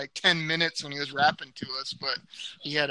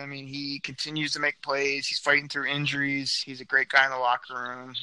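ordinary delivery

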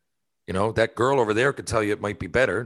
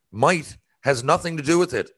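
normal talking voice

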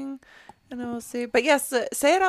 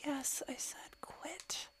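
frustrated whisper; 10 dB quieter than usual. Real, not acted. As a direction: hiss it under your breath. Whispered frustration over tech trouble.

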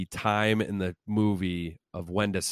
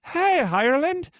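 put-on loud, very high-pitched botched Irish accent; mock Irish Gaelic accent; 6 dB louder than usual. Host opening an Irish ad for listeners, mock Irish accent. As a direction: hail them in a bad, piercingly high Irish accent. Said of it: "to do an ad in".

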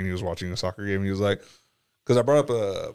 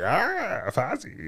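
high, goofy Muppet voice; hammy Fozzie Bear impression; 10 semitones higher, 4 dB quieter, much slower than usual. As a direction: chirp it like a silly puppet bear. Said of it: "Fozzie Bear thing that annoys the shit out of Hannah".

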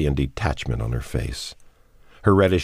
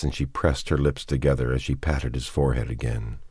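ordinary delivery

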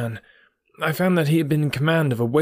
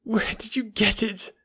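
high, slow, anguished question; groaned; 7 semitones higher, 4 dB quieter, slower than usual. A narrator's reading. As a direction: groan it out slowly, pitched high. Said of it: "he groaned".

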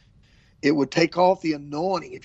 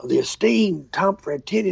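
normal talking voice

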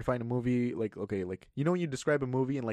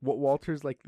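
ordinary talk, nothing marked

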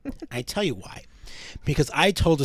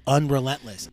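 normal talking voice

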